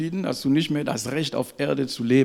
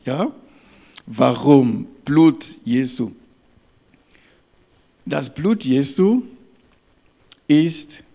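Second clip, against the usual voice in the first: emphatic preaching; 5 dB louder, slower than usual. Acted. Slow emphatic sermon passage.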